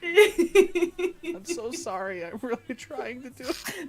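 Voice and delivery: very high, squeaky with laughter; laughing apology. Real, not acted. Host apologizes for failed impression, laughing helplessly. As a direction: squeeze the words out through real giggles.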